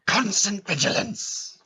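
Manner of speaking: drawn-out theatrical exclamation; Moody. Acted. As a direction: bellow it as a hammed-up warning.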